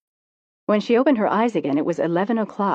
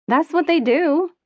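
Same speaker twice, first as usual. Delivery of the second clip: airy, carefree and much higher; blithely; 10 semitones higher, 4 dB louder, slower than usual. A narrator's reading. Friend on the phone, breezy about the abortion. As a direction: toss it off like nothing. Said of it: "Liz said blithely".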